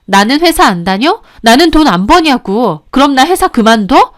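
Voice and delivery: heated wife, voice climbing on each question; indignant retort. Acted. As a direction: fling each question back at the husband. Wife retorts in marital quarrel.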